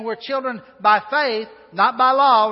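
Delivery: rising to a loud punch on law; preaching emphasis. Acted. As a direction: hammer home not by law. Preacher driving home contrast.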